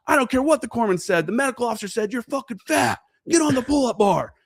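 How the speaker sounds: high, harsh drill instructor bark; angry drill instructor. Retold or imitated. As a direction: chew them out like a drill instructor. Drill instructor berating recruits.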